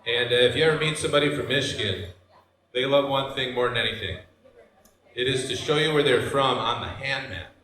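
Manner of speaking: normal talking voice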